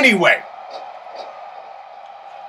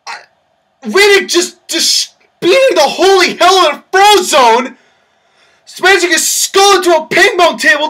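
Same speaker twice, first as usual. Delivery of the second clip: frantic excited call; 6 semitones higher than usual. Acted. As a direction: lose it on the mic.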